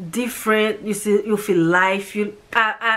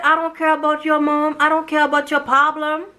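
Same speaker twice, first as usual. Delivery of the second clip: higher, dismissive retold boss, mildly heated; angry boss; 7 semitones higher, faster than usual. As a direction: brush her off like an angry employer. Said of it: "The lady was so mad".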